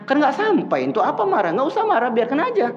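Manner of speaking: high, mocking reply; teasing retort. Preacher teases the angry skeptic, high and mocking.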